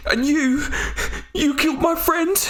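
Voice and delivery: frightened man accusing the killer of his brother; trembling accusation. Acted. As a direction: point and accuse while shaking. Stranger accuses Leova.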